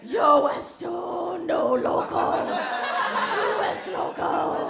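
hammed-up Spanish mom shouting; Spanish mother yelling. Comic imitating every mother in Spanish, yelling. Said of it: "every mother ... in every language".

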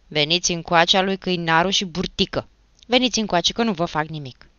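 loud, firm summons; calling over. A narrator's reading. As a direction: order the two boys to come here. Achim summons the two culprits.

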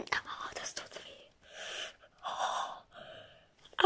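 soft pained whisper. Acted. Hurt knee, keeping quiet, pained whisper. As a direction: breathe the pain out in a whisper.

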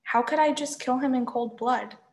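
usual speaking voice

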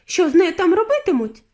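high, loud, anxious demand; alarmed question. A narrator's reading. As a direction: ask it in rising alarm. Alarmed mother asks.

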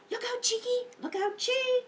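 high, gasping warning cry; alarmed warning. Acted. Librarian warning the story monkeys, high and gasping. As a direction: call out to warn the little monkeys.

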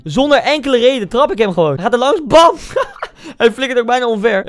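amused laughing retelling. Real, not acted. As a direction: laugh at your own silly foul. Laughing at own kick.

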